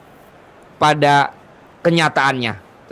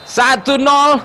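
excited score call; 7 semitones higher, much slower than usual. Real, not acted. Score called after goal.

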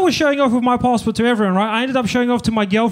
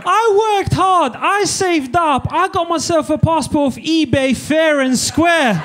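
high, slow, emphatic self-defence; hammed-up righteous comeback; mock indignation; 6 semitones higher, slower than usual. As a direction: proudly protest each point, drawn out. Indignant comeback to the old lady.

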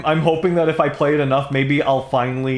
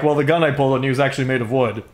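quick smug explanation; faster than usual. Retold or imitated. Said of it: "he's like".

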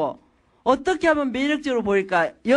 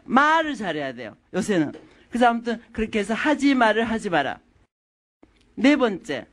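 normal talking voice

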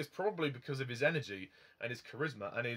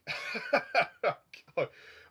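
laugh; 8 semitones higher, 3 dB louder than usual. Real, not acted.

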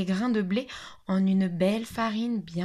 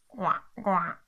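duck quack. Acted. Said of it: "un canard qui ne savait que cancaner".

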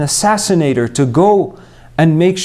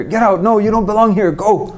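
higher mock shout at a newcomer; 5 semitones higher than usual. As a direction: act out a rude shout, a bit higher. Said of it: "Did he shout at her".

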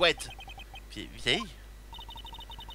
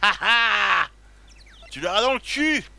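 loud, higher gotcha jeer; triumphant gloat; 5 semitones higher, 6 dB louder, slower than usual. Real, not acted. Player gloating at the game, loud and triumphant. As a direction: crow over the win.

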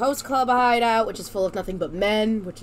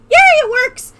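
excited cheer; 14 dB louder than usual. Real, not acted. Tech finally works on stream.

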